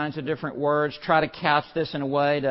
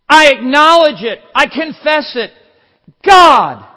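shouted, much higher, desperate; anguished cry to God; 13 semitones higher, 19 dB louder than usual. Acted. Sinner crying out to God, shouted confession.

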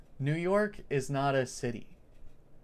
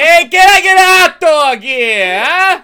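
stadium vendor hawking; 20 dB louder, slower than usual. Retold or imitated. Mimicking ordering at cart.

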